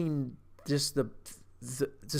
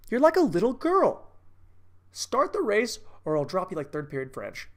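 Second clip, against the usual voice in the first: Scott Conn; 6 semitones higher than usual. Retold or imitated. Quoting a favorite movie line as the meathead, taunting impression. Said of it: "I'd be Scott Conn".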